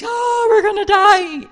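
high, loud wail of doom; panicked wail. Acted. Doomed townsfolk hearing the marchers, panicked wailing. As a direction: wail it out in hammed-up terror. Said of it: "Everyone's like".

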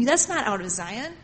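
ordinary talk, nothing marked

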